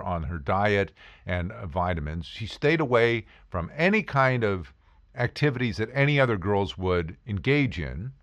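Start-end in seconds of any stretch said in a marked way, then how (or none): none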